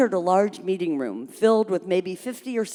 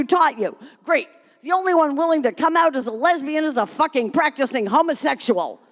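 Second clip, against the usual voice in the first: rip into Penny nonstop; yelling; 9 semitones higher than usual. Acted. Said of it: "Another woman yelled".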